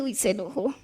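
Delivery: soft, low hushed remark; hushed aside. Retold or imitated. Wife notices her husband is angry, hushed aside. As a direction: murmur it under your breath, a bit wary. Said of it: "yo dije".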